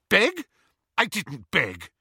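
high, loud indignant yelp; indignant sister. A narrator's reading. Petunia denying she begged, high and indignant. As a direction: deny it with outrage.

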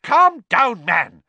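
loud, high barked order; Fudge barking. A narrator's reading. Minister ordering a raging professor down, barking. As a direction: bark at him to get a grip. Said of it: "Fudge barked".

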